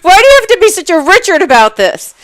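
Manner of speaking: high, loud exasperated retort; retold outburst. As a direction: fling the insult back at him. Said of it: "I didn't say Richard".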